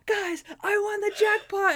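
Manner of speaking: high-pitched, elated burst; excited announcement. Retold or imitated. Captain bursts into the office, excited announcement. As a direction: burst in with imitated jackpot news.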